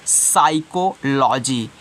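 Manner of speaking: साइकोलॉजी starting on s, slowly; silent p. Shown as a demonstration. Teacher showing students how psychology is read, p silent in साइकोलॉजी. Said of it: "जब पी नहीं पढ़ोगे".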